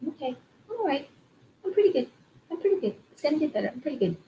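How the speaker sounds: high-pitched little pep talk to your own reflection; mirror self-talk. Pretend. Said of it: "look in a mirror and just say".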